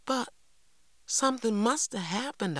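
put-on high, insistent child's voice; protesting girl. Girl disputes that nothing happened, protesting. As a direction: object in a high, swooping voice.

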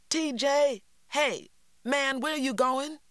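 high, loud call down the road; Stacy yelling after T J; yelling after him. A narrator's reading. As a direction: shout after a boy running off. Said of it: "Stacy yelled after him".

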